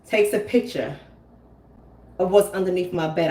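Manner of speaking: ordinary talk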